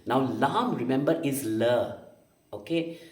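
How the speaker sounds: ordinary talk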